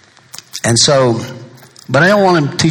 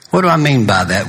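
ordinary talk, nothing marked

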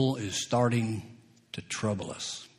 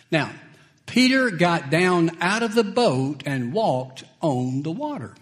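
ordinary talk, nothing marked

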